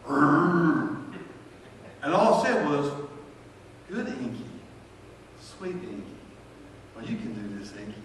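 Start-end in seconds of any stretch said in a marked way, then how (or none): 0.1-1.0 low imitated dog growl. Imitating his dog growling at the pills, a low growl. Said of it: "and she going". 3.9-8.0 much softer, tender coaxing; soothing the dog. Retold or imitated. Owner softly coaxing his dog Inky to swallow pills, tender and hushed.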